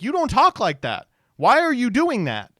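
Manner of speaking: high, loud, baffled objection; mock incredulous protest. Heckling a politician over his fake voice, incredulous. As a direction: challenge him in exaggerated disbelief.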